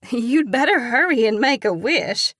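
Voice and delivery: loud, teasing high voice; joking. A narrator's reading. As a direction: tease them playfully. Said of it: "Pen jokes".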